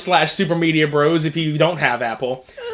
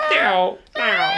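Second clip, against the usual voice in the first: high squeaky parrot echo; parrot squawk; 3 dB quieter than usual. Retold or imitated. Echoing the parrot woman.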